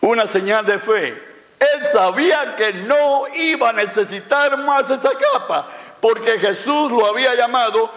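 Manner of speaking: high-pitched, emphatic sermon climax; impassioned preaching. Acted. Preacher telling why the blind man dropped his cloak, impassioned preaching. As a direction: preach it with rising fervour, drawing out key words.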